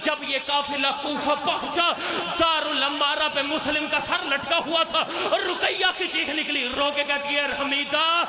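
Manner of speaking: high, building mourning recitation; climactic majlis lament. Acted. Reciter driving the mourners toward the climax, high and keening.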